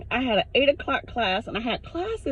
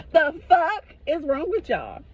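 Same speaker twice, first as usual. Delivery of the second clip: ordinary talk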